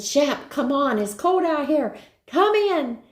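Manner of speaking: rising high calls to the dog; calling the dog in. Retold or imitated. Sitter at the door calling Shep in, high and rising. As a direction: coax the dog in, pitch climbing high.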